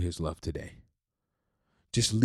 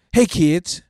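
put-on high, loud cheerful call to kids; playful cashier; 8 semitones higher, 10 dB louder than usual. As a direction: greet the kids brightly. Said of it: "I shift into a game".

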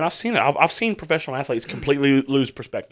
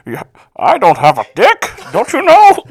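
loud, higher mock voice breaking into laughter; mock Bane voice; 8 semitones higher, 12 dB louder than usual. Podcaster continues the joke voice, loud, laughing. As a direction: push the mock voice till laughter takes over.

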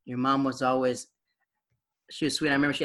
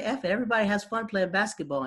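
high, carefree shrug; teen defiance; 7 semitones higher than usual. Retold or imitated. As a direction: brush it off. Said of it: "I was like".

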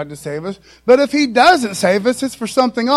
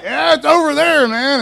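tossed off, higher and louder, a sly fake helpfulness; mock breezy misdirection; 6 semitones higher, 4 dB louder than usual. Hebrew guy misdirecting a lost Roman, breezy and higher. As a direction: play the smug local pointing the wrong way. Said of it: "They're like".

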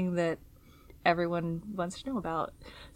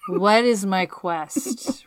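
usual speaking voice

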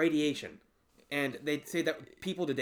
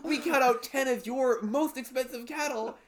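TV prank host; 9 semitones higher, 7 dB louder than usual. Pretend. Pranking farmers on a TV show.

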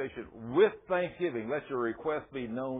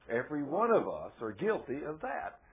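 ordinary talk, nothing marked

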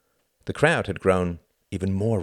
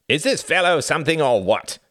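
high, loud hearty exclamation; loud cry; 10 semitones higher, 7 dB louder than usual. A narrator's reading. Reginald clapping Tom on the back, crying out. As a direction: cry it out over the crowd. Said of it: "cried Reginald".

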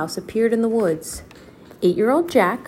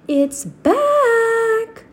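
high, loud excited call; 5 dB louder than usual. Acted. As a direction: shout the good news from the trees. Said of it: "Annie called from the woods".

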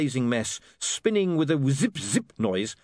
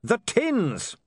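shouted warning; 11 semitones higher than usual. Acted. William warning dwarfs of fire.

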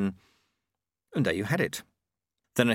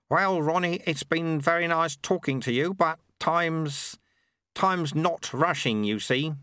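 put-on raised, chatty old monk voice; a genial old man making small talk; Lutze, amused, over a cup of tea; 7 semitones higher, slower than usual. Lutze taking his leave.

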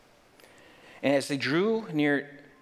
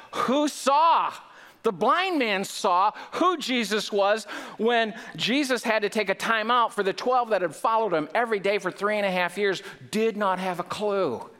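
much higher and louder, driving the point home; preaching at full force; 9 semitones higher, 3 dB louder than usual. Acted. Pastor presses the disciples' blindness on his listeners, fervent and high. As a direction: preach it hard to the congregation.

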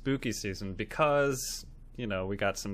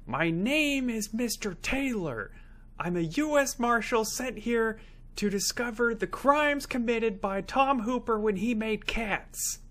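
higher, slow self-important lawman voice; hammed-up fake Mister Taylor; mock deluded US Marshal; 10 semitones higher than usual. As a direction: play a pompous marshal who believes every word. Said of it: "And scene".

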